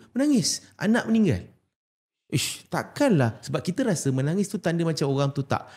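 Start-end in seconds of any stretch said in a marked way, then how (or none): 0.1-1.5 high incredulous exclaim; high, disbelieving cry of surprise. Retold or imitated. Incredulous retelling of weeping. 2.3-3.4 slow drawn-out disbelief; slow scoffing doubt; disbelieving. Retold or imitated. Disbelief at Prophet weeping.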